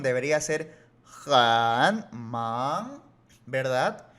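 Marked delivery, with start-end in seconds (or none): 1.3-3.0 loud HAN dipping and rising, then MAN; full third tone. Shown as a demonstration. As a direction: separate "han" and "man" with complete tones. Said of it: "con los tonos que son".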